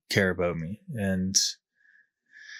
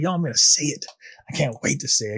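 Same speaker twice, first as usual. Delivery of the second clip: pitched up and eager to confess; keen newcomer bursting to speak; 5 semitones higher, faster than usual. Retold or imitated. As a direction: blurt it out like you can't wait. Retold eagerness at first meeting.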